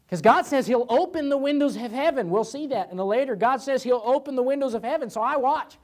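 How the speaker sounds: high-pitched, insistent proclamation; fervent preaching. Real, not acted. Preacher telling congregation of heaven's windows, fervent. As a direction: preach the promise with expectancy.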